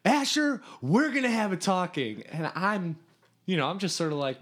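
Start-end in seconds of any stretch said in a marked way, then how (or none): 0.0-2.2 name called out high and loud, then a firm warning; stern call-out. Retold or imitated. Counselor singling out the camper, loud and stern. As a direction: call him out like a boss who caught him. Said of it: "and he goes".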